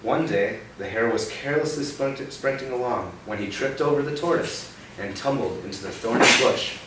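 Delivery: bright read-aloud for a little one; storybook reading. Acted. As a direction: tell it like a picture-book story. Reading picture book to child.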